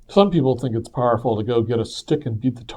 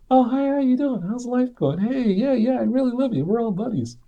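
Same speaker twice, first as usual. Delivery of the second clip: much higher, sugary mock cheer over resentment; fake friendly; 12 semitones higher than usual. Debtor greeting the man he resents with fake cheer. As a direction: put on a falsely bright friendly greeting.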